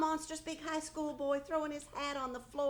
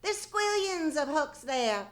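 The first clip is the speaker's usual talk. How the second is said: calling out; 5 semitones higher, 7 dB louder than usual. Acted. Mother calling to son elsewhere.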